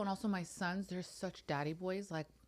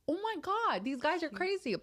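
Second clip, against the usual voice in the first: exasperated; 12 semitones higher than usual. Retold or imitated. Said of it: "I'm like".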